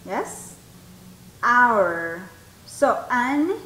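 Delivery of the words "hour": "Hour" low and louder, opening on the vowel; silent H. Shown as a demonstration. Teacher repeats for students, low, loud "Hour" with silent H. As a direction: punch out "Hour" as "our", without any H. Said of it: "Escuchen el sonido".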